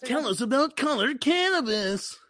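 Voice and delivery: hammed-up high cartoon voice; Care Bear. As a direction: ask it like a chirpy cartoon bear. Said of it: "I can just do the show like that".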